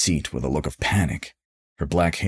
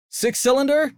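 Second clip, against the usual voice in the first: high hollered question; Gary hollering from the back seat. A narrator's reading. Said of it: "Gary hollered".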